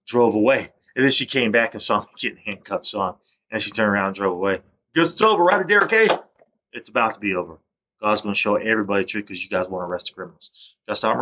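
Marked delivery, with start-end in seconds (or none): 4.9-6.1 high, raised voice, re-enacted call; pitched-up retold holler. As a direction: call it out high and louder to someone leaving. Calling out to departing niece.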